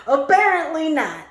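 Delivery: high, loud, laughing "Apparently not". Real, not acted. Reader laughing at the reading. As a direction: toss it off through a laugh.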